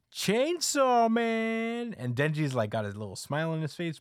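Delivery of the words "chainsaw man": high, loud, drawn-out summoning call; sustained high holler. Retold or imitated. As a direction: chant the name out slowly like summoning a hero. Retold call summoning Chainsaw Man together.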